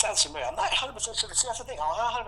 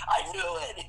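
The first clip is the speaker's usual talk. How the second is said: high, delighted aha; hunter's gleeful mock revelation; mock triumphant realization; 13 semitones higher than usual. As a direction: nail the discovery. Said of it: "It's like".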